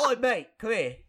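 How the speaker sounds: American having a go at British; imitated British accent. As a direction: copy a British call with a laugh. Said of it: "Is that good?".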